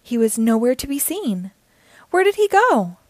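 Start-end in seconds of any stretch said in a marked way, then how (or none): 2.1-3.0 anxious, higher, louder question; worried question. A narrator's reading. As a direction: ask in sudden worry. Puppy missing.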